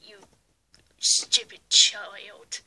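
slow, low sneering villain. Acted. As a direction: drag out the insult slowly.

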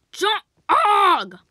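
"Jog" drawn out high and loud; insistent correction. Acted. Correcting a friend who says Job, insistent "Jog".

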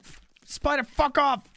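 frantic while being chased; scared horror game streamer. Real, not acted. Chased by spider, near death.

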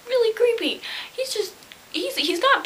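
squeaky high disgusted pitch; creeped out. Real, not acted. Telling viewers how creepy the man was, squeaky high. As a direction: jump way up in disgust.

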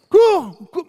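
loud, high "Cours"; shouted command. Real, not acted. Coach shouting at a reluctant runner. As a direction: yell the run.

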